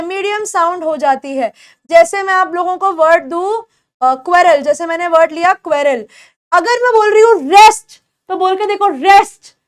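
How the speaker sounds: usual speaking voice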